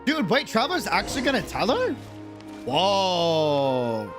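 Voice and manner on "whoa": usual speaking voice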